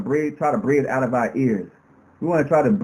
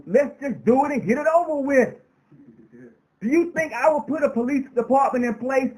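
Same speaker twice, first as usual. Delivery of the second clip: higher, driving rally preacher voice; preaching at full force; 7 semitones higher than usual. Acted. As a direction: rouse the crowd with every question. Impassioned rally appeal.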